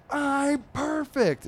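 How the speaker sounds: very high, slow, strained I'm perfect; crazy angry. Acted. Furious comic dog answers his owner, hammed-up strained yell. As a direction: grit it out like you're about to explode. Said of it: "he looks crazy angry".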